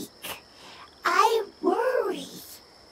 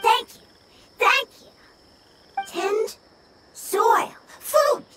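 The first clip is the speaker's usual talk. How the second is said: higher and louder, eager little cries; eager thanks; 4 semitones higher, 4 dB louder than usual. Acted. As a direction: blurt out thanks and wishes. Grateful creature, broken speech.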